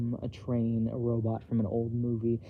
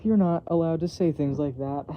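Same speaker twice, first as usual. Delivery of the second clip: attempted sternness; 5 semitones higher, 6 dB louder than usual. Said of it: "An attempt at sternness".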